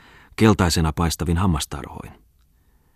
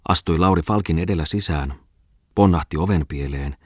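plain reading voice

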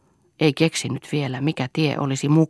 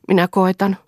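lightly; 6 semitones higher, 6 dB louder than usual. A narrator's reading. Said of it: "vastasi kevyesti".

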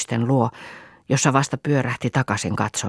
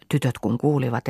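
usual speaking voice

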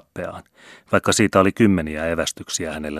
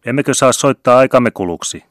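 higher, louder shout into the wind; shouted reply; 6 semitones higher, 7 dB louder than usual. A narrator's reading. Bandleader shouting from the pier to the vicar, loud and high. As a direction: yell it back over the water. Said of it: "huusi Finn Paulsenin Arnold".

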